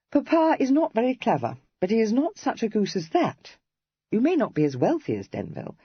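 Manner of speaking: a hint of a spirited young lady teasing; Cressy. A narrator's reading.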